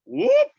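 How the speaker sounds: very high, loud whoop; startled whoop. Retold or imitated. Man reacting to news of cops out back, startled whoop. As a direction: imitate a sharp startled whoop.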